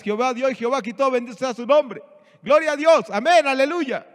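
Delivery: building to an exaggerated glory-to-God cry; mock jubilant praise. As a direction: parody a man cheering through disaster. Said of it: "estaba contento, estaba riéndose?".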